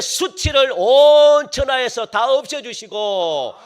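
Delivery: full-force preaching. Real, not acted. Preacher telling believers what heaven brings, full-force preaching. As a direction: stretch the key words in rousing proclamation.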